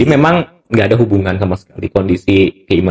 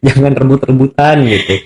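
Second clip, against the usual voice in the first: laughing through the words, higher pitch; amused chuckle; 4 semitones higher than usual. Real, not acted. Counselor joking that experts shouldn't compete, amused and laughing. Chuckles at own remark on turf fights.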